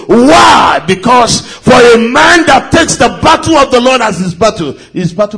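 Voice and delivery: preaching. Acted. Preaching at full force.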